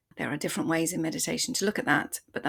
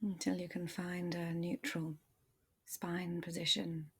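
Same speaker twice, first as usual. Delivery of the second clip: calm body-scan voice, softer than the intro; guided meditation; 4 dB quieter than usual. Acted. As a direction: ease the listener into their body, gently.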